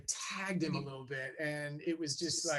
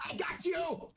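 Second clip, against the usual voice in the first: high, laughing shout as Ray Liotta; a gotcha cry in Ray Liotta's voice, laughing through it; Ray Liotta impression; 14 semitones higher, slower than usual. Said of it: "Good impression".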